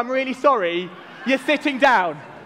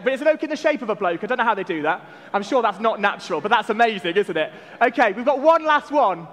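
normal talking voice

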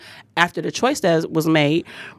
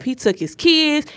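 ordinary delivery

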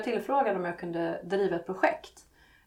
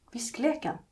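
plain reading voice

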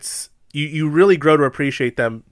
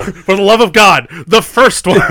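loud, high exasperated cry; exasperated shout; 7 semitones higher, 9 dB louder than usual. Real, not acted. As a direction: yell it in disbelief. Exasperated correction.

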